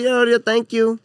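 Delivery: high-pitched imitation Chinese accent; mock Chinese accent. Chinese lady serving a buffet diner, mock Chinese accent. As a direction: play a server with a put-on Chinese accent. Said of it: "pretend you're the Chinese lady".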